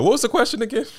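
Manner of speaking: loud, high mock bewilderment; mock confused high voice. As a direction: play the airhead who forgot the question. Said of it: "And then we're like".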